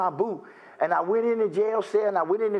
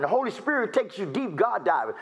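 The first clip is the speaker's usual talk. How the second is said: usual speaking voice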